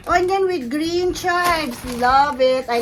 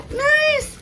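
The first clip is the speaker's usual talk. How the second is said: much higher, delighted cry of joy; thrilled squeal; 11 semitones higher, 4 dB louder than usual. Real, not acted. Unboxing a gift from Miss Annette, thrilled squeal.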